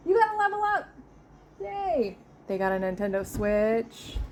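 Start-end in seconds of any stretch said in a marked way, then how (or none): 0.1-2.2 high, bright mock-excited echo; cheery echo. Real, not acted. Echoing her son's game news, high and excited.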